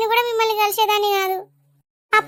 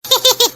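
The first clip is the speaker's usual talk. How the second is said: short laugh; 3 semitones higher than usual. Acted. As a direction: let out a short giggle. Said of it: "అట్లా పల్లిగిలియకే". (చిన్నగా నవ్వు)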